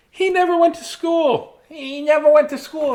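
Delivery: high-pitched tattling man; squeaky man voice. Acted. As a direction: make him squeak it out.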